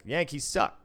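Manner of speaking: loud, high jeering call; loud heckle. Retold or imitated. Needling a Yankee fan within earshot, loud heckle. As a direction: call it out loudly to needle him. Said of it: "just saying that loudly".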